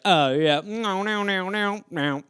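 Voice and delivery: soft, fading English declining; polite refusal. Retold or imitated. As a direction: decline politely, trailing to a murmur. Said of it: "everyone's like".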